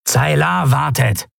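a slow, lightly voiced thug calling out; slow call to a comrade; a call from Kratergesicht. A narrator's reading. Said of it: "rief Kratergesicht".

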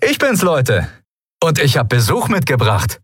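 a breezy, good-mood hello; light jovial announcement; cheerful. A narrator's reading. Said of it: "in bester Laune zu".

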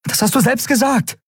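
high-pitched heated accusation; angry outburst. A narrator's reading. Woman confronting captive about his own words, heated outburst. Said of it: "platzte es aus ihr heraus".

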